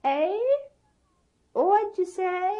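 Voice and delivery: put-on high, small old woman's voice; little faraway voice. Grandma answers from inside the giant's head, high and small. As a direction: call back tiny from inside. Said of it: "came a little voice".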